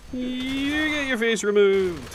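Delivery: high, slow silly voice; squeaky cartoon menace. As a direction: repeat it as a goofy cartoon baddie. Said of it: "repeated in a sillier voice".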